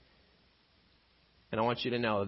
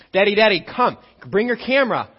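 put-on higher and louder, an eager kid calling Daddy; excited child calling; 9 semitones higher, 12 dB louder than usual. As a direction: shout it like a thrilled little boy. Son calling his dad outside.

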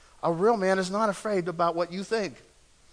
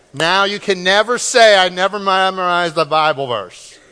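raised voice carrying over the room; loud proclamation; 9 dB louder than usual. Real, not acted. Preacher tells congregation they have memorized a verse, loud proclamation. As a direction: call it out loudly, easing slightly toward the end.

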